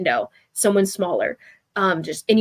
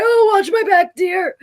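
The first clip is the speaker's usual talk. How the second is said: put-on much higher, creaky old man's voice; old man quipping, pitched way up; 10 semitones higher, 6 dB louder, slower than usual. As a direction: pipe up like a fragile grandpa being carried. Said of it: "He's like".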